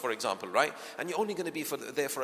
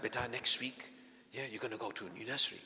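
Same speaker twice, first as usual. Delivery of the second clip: much softer, excited but hushed; drip-feed the news softly; gentle parent; 3 semitones lower, 7 dB quieter, faster than usual. Retold or imitated. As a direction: parent telling child about new nursery, much softer. Parent preparing child softly.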